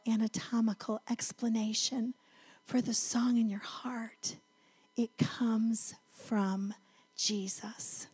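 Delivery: put-on soft hushed whisper to a small child; tender whisper. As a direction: whisper it like a secret to your daughter. Mother answering daughter about Jesus.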